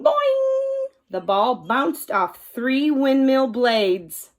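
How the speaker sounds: ordinary delivery, nothing marked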